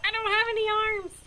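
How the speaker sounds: a flower explaining it can't move, voiced high; second talking flower. Second flower explaining it has no arms, high character voice. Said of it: "And this one, he says".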